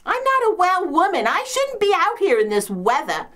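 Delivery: high-pitched put-upon lament; self-pitying complaint. Acted. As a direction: complain like a long-suffering invalid. Complains of being a sick woman.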